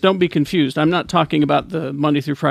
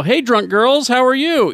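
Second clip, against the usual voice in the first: radio DJ voice; 9 semitones higher, 4 dB louder than usual. Retold or imitated. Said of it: "break in and go".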